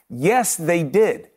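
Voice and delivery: very slow, each word weighted; slow firm yes. Real, not acted. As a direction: concede it slowly and deliberately. Conceding a point with emphasis.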